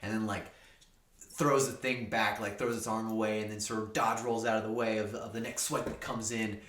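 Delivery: ordinary delivery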